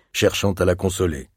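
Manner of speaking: usual speaking voice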